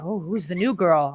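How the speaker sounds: low, soft mock-curious voice; hint of a put-on clueless voice; clueless commentator. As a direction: lightly imitate an oblivious man asking. Imitates oblivious commentator.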